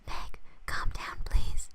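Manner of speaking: breathy whispered plea. Shown as a demonstration. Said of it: "Should it, he whispers, so".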